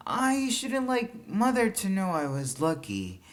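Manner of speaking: put-on high, eager young boy; lightly voiced child, keen to explain; a boy's bright voice. A narrator's reading. Boy pleading to uncle.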